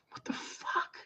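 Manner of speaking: voiceless, incredulous whisper; hushed, fed-up astonishment; exasperated whisper. Real, not acted. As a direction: whisper it in sheer disbelief. Exasperated at the movement.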